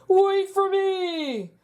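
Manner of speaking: put-on slow, high frog call after the kids; a lightly voiced frog calling out; mild frog cry. A narrator's reading. Frog principal calling after the running kids, slow and high. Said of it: "cried Mister Bundy".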